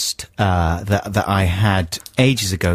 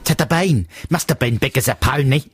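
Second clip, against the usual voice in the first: higher-pitched mock of botched Australian; bad Australian accent; 4 semitones higher than usual. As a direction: mimic an actor's mangled Australian vowels. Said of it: "American actor trying to do an Australian accent".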